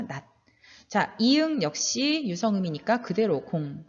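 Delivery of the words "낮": 낮 ending as nat, t unreleased; final ㄷ demonstration. Shown as a demonstration. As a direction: model 낮 with a stopped t. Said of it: "디귿으로 발음하라고 했으니까".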